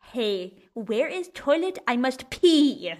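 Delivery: slow, loud, broken-language plea; clumsy foreign speaker. Acted. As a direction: blurt it word by word like a clumsy learner. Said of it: "in German, I'm like".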